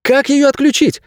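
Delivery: very high, urgent acted yell; high-pitched shout without full volume; crying out. A narrator's reading. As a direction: call out in alarm, pitch way up. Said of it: "прокричала девчонка".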